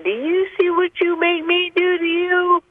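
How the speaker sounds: high, strained-back mock scream; imitated raging mother. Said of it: "she's beating me with her fists up and down my back, saying".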